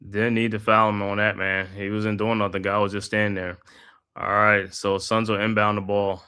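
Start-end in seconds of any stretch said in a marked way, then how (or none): 4.2-6.2 steady broadcaster's call of the action; live basketball play-by-play commentary. Acted. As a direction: call the game like a courtside announcer. Calls the game play by play.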